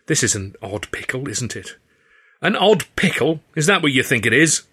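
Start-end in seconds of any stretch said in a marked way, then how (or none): 2.4-4.6 too loud. A narrator's reading. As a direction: throw her words back in disbelief. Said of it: "I said, too loud".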